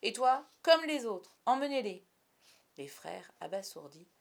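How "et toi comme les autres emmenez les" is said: loud, high, commanding shout; imperious leader's bellow; peremptory. A narrator's reading. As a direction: cut off all argument with an order. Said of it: "trancha Peter".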